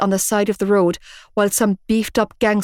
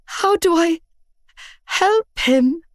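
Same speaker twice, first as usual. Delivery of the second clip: tearful; 10 semitones higher, slower than usual. A narrator's reading. Tearful plea.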